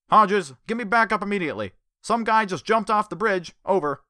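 loud, high urgent police radio call; cop on the radio. A narrator's reading. The cop radioing Hodges for backup, loud urgent call. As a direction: report in to dispatch urgently.

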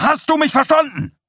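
very high, loud demand; furious shout. A narrator's reading. Husband shouting over his wife's prayer, furious demand. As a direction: shout over the other person.

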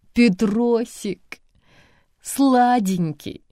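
put-on slow sing-song baby talk; cooing baby talk. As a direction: coo to a baby, drawn out. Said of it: "засюсюкала тётя Валя".

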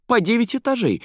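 high-pitched, breathless-with-wonder gushing; wide-eyed excited description. A narrator's reading. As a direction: pour out the marvels, swooping high. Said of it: "взахлёб описывала Заира".

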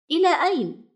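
put-on high, young chick asking where to; high squeaky chick voice asking mother; little bird's voice. A narrator's reading. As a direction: lift into a small child's voice, surprised. The little bird asks his mother.

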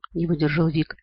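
plain reading voice

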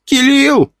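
put-on high, bright child's cry of a name; little girl shouting. A narrator's reading. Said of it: "с криками".

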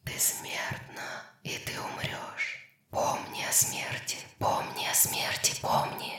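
hushed, voiceless whisper of doom; very soft ghostly whisper; eerie whisper. Acted. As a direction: whisper it like a haunting death chant. Eerie voice chants death warning.

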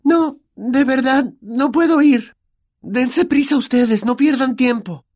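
put-on very high, strained girl's voice, slow; hesitant, stammering refusal in a high pitch. Hermione behind the door refusing to come, high and hesitant.

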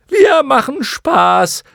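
very high, slow, loud squawk; clown moth declaring. A narrator's reading. Third moth explains to the others, declaring loudly. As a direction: declare it loudly in a silly creature voice. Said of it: "erklärte eine dritte".

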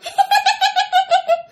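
high-pitched ghostly cackle; eerie savage cackle. Retold or imitated. Ghost laughing at dad in his hammock, savage high cackle. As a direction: cackle wildly in rapid, high bursts. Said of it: "Tiếng cười thấy ghê lắm, mang rợ lắm".

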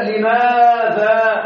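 لماذا stretched very slowly, every syllable held; drawn-out word. Shown as a demonstration. Teacher holds out لماذا for students, very slow. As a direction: hold out لماذا long and slow to spotlight it. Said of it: "إذا قلنا".

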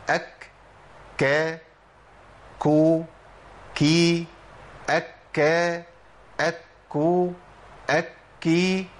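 each syllable from أك to أكي with a puff of air on the k; breathy k drill. Shown as a demonstration. Teacher repeating all seven cases for students. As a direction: recite the seven k forms, aspirating each. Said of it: "اسمعهم تاني".

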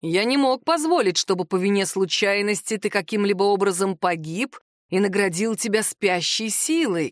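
ordinary delivery, nothing marked

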